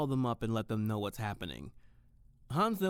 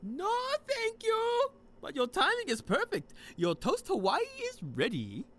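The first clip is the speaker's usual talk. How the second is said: cheerful Hans; 4 dB louder than usual. Acted. Hans calling out to Tiffany that the toast is ready, high and cheerful. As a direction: bounce it high like a jolly host.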